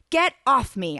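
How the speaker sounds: sharp, loud demand; shouting. A narrator's reading. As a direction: shout to push him off. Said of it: "I shouted".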